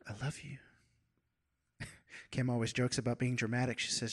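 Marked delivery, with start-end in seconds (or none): 0.1-0.6 soft whispered words, almost no voice. Shown as a demonstration. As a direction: drop to an unvoiced whisper. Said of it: "Sometimes we want to yell".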